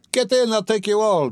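high, carrying call; shouted. A narrator's reading. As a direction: call the whole crowd aboard. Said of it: "Mikolka shouted again".

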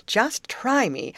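high, drawn-out eager exclamation; playful storybook eagerness; eager cry. A narrator's reading. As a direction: dare them keenly, slowly. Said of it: "cried Molly".

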